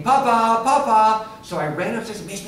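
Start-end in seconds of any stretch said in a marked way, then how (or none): 0.0-1.3 high, loud calling for dad; child calling out. Retold or imitated. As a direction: call up the stairs like a little boy. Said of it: "he's saying".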